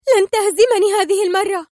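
high, loud furious cry; hysterical anger. A narrator's reading. Sonia leaps up at her enemy, hysterical anger. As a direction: shriek it in hysterical rage. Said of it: "تصرخ في غضب هستيري".

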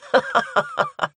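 high-pitched burst of laughter; mocking cackle. A narrator's reading. Demoness laughing at the heroine's promise, high mocking cackle. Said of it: "расхохоталась демоница".

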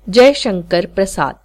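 usual speaking voice

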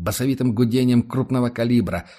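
usual speaking voice

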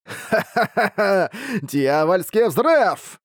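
slow cackling villain cry; evil monster boss. Acted. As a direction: cackle then roar the spell name. Monster boss casting spell.

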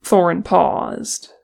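plain reading voice

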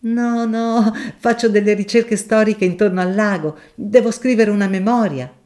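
a shy professor refusing a kindness; mild professor. Acted. Professor explains research.

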